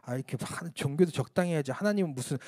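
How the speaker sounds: half-voiced churchgoers sneering at him; a quiet, scoffing retelling of gossip; muttered gossip. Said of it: "수군거리기 시작했습니다".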